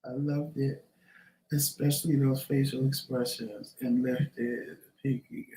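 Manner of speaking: slow breathy ASMR whisper. Real, not acted. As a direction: read the comment in a hush, slowly. Reads chat comment in ASMR.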